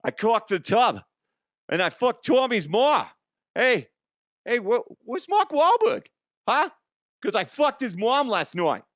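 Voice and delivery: high, brash mock Bostonian; terrible Boston accent. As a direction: ham up a bad Boston accent. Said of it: "I'm terrible at the Boston accent".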